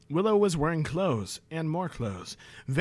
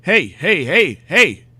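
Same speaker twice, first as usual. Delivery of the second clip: real loud shout to interrupt; 3 semitones higher, 12 dB louder, slower than usual. Real, not acted. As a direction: shout over the intruder. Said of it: "I'm narrating fan fiction".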